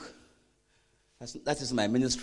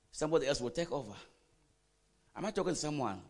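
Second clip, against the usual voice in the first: normal talking voice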